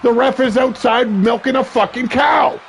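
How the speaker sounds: high, indignant shout; exasperated rant. Real, not acted. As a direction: vent at the useless ref. Ranting about the referee.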